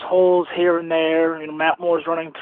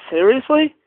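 incredulous; 6 semitones higher, 4 dB louder than usual. Real, not acted. Caller venting about team.

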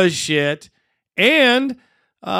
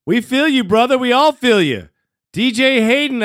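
usual speaking voice

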